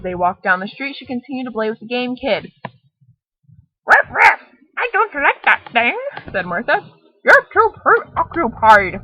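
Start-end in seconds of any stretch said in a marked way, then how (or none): 3.8-6.0 high, loud yappy puppy barking words; talking dog voice. Acted. Martha the dog speaks. 7.2-9.0 dog scolding. Acted.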